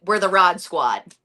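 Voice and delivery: ordinary talk, nothing marked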